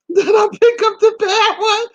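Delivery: high, loud squeal of laughter through the words; shrieky, incredulous laughing delivery; laughing hard. Real, not acted. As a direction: crack up while getting it out. Laughing at her own choice.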